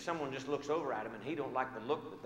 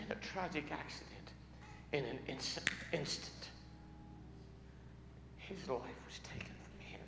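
grieving hush; 7 dB quieter than usual. Retold or imitated. Telling of son's death.

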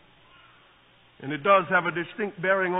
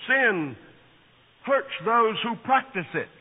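ordinary delivery

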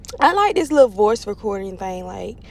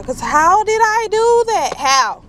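loud, high, drawn-out exasperation; frustrated, rising cry of self-blame; mad at self; 11 semitones higher, 5 dB louder, slower than usual. Real, not acted. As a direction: wail it out in disbelief at your own mistake. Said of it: "I'm so mad right now".

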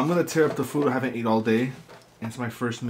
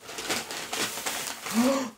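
shocked gasp; 12 semitones higher, 7 dB quieter than usual. Real, not acted. No fork found.

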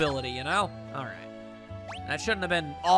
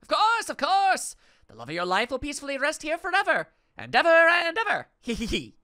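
feigning madness; 8 semitones higher, 4 dB louder than usual. Acted. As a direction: ham it up like a gleeful lunatic. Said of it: "The music implies intense wackiness".

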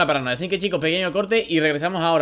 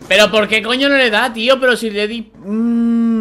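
louder, higher frustrated outburst; real frustration, louder and higher; getting angry; 7 semitones higher, 7 dB louder than usual. Real, not acted. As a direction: vent at the game getting worked up. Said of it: "me estoy cabreando".

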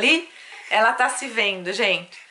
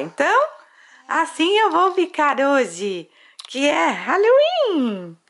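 put-on higher, slow, cute baby voice; voz de bebê; 6 semitones higher, slower than usual. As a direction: speak for the baby in a sweet high voice. (voz fininha de bebê, devagar)

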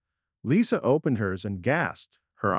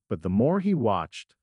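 ordinary delivery

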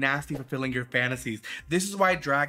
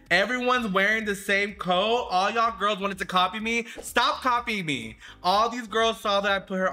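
indignant accusation; 8 semitones higher than usual. Real, not acted. Accusing the other girls of copying her coat, higher and indignant. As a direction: call them out for copying.

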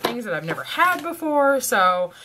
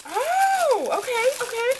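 very high breathless okays; squeaky thrilled stammer; overwhelmed delight. Real, not acted. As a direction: lose it over the present. Overwhelmed by the gift.

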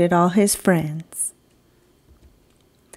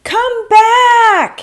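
very high, loud shout; 14 semitones higher, 7 dB louder than usual. Acted. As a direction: shout after them. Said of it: "he shouted".

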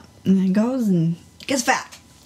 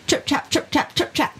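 hoof clatter; 5 semitones higher than usual. Pretend. Goat trotting across the bridge.

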